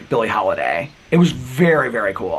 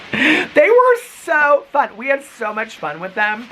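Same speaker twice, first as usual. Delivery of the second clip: gushing; 11 semitones higher, 3 dB louder, slower than usual. Real, not acted. Gushing about fun couple.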